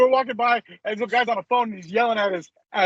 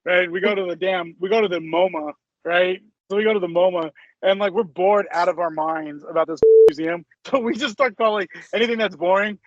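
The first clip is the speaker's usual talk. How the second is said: ordinary talk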